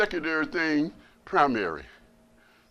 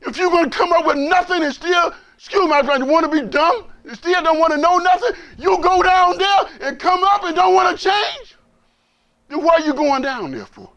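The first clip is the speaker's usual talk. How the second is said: full-force preaching; 10 dB louder than usual. Acted. Preacher driving home to the brothers that Allah sees everything. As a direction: preach it out to the whole room.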